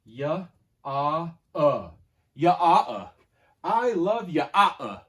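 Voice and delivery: sounding out Y, ah, uh letter by letter, loud; Y, ah, uh sounded out phonetically, loud and stretched; sounding out words. Shown as a demonstration. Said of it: "it would sound like this".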